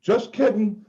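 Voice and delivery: playful take-back. Retold or imitated. Taking back heckle to ref.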